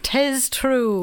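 hammy stage-Irish brogue; mock Irish accent. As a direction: quote it in a fake Irish lilt. Mock Irish quoting the book.

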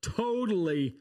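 laid-back California surfer drawl; American surfer accent. Pretend. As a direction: say it like a stoked surfer dude. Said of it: "I cannot do an American accent".

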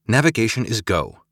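usual speaking voice

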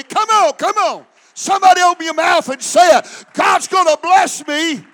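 loud, climbing sermon declarations; preacher urging the congregation to declare; preaching at full force. Real, not acted. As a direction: stir the room up.

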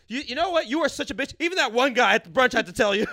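loud high mock yell at girlfriend; hammed-up boyfriend blowing up; imagined boyfriend yelling at his girlfriend, loud and high; mock angry boyfriend. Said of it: "he's gonna be like".

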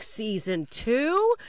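ordinary talk, nothing marked

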